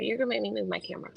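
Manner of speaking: ordinary talk, nothing marked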